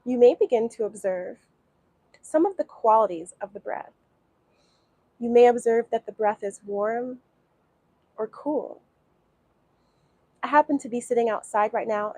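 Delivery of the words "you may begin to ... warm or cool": guided meditation. Acted. Teacher guiding beginners through breathing, calm meditation voice. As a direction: ease them into watching the breath.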